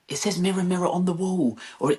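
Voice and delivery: put-on high-pitched child correcting Dad; frustrated child. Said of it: "got increasingly frustrated with me".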